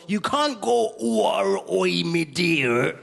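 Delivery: put-on broad, drawn-out Bristolian accent; exaggerated Bristolian impression, vowels stretched out. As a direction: ham up a slow Bristolian burr. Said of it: "not to use the Bristolian accent".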